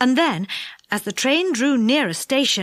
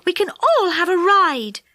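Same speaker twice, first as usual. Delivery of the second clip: excited; 7 semitones higher, 3 dB louder than usual. A narrator's reading. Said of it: "nearly mad with excitement".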